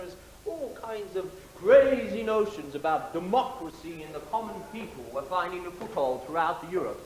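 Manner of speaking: usual speaking voice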